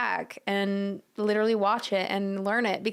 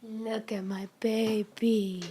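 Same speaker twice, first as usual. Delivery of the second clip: ordinary delivery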